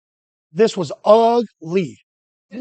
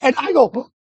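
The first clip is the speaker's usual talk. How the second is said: normal talking voice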